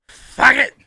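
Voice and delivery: high, loud imitated curse; mock drunken outburst. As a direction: burst out like the fed-up drunk. Acted quote of drunk host.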